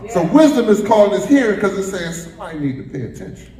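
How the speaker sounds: normal talking voice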